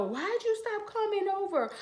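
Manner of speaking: a lightly voiced, falsely caring relative; fake concerned relative. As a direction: play the relative pretending not to know. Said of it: "They will pretend like they don't know".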